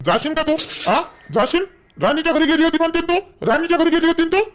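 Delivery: high, loud interrogation; furious accusation. Acted. Husband confronts wife over her visit, furious. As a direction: hurl each question at her like a charge.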